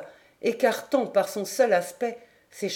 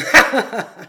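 loud burst of sinister laughter; chilling drunken laugh; 4 semitones higher, 12 dB louder than usual. A narrator's reading. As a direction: break off into a laugh that makes listeners shudder. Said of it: "Sa phrase finit par un rire qui fit frissonner Pierre".

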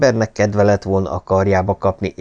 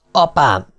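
louder, raised cry of delight; joyful cry; 5 semitones higher, 4 dB louder than usual. A narrator's reading. Pet crying out to his father in delight. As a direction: call out to father happily. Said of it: "kiáltotta".

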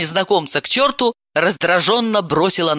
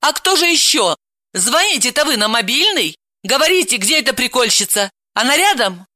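spiteful, higher-pitched comeback; irritated, pitched up; angry retort; 6 semitones higher than usual. Acted. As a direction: fire the questions back crossly. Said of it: "Она зло ответила".

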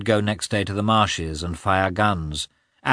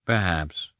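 dry. A narrator's reading. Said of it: "said Lievin dryly".

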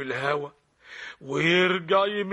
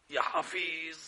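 quiet dismay; 8 dB quieter than usual. Acted. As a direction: murmur it in dismay. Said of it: "same words said again louder".

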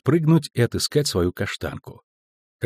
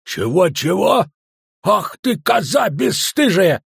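high, drawn-out indignant scolding; outraged shout; 9 semitones higher, 4 dB louder, slower than usual. A narrator's reading. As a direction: roar at her cheek, stretching the words. Dwarf outraged at the girl's cry. (возмущённый крик, с растяжкой)

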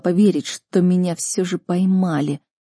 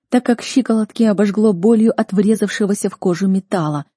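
ordinary delivery

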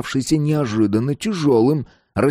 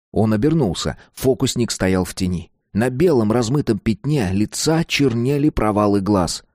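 plain reading voice

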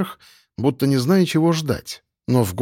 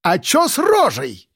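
high, slow, indignant yell; angry, high-pitched shout without much volume; 8 semitones higher, slower than usual. A narrator's reading. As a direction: yell at him like an irate woman boss. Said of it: "кричала она".

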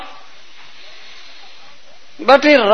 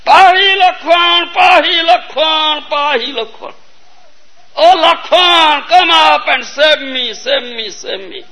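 desperate cry for help; 6 semitones higher, slower than usual. Acted. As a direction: call for help, then let it die away. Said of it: "cried out".

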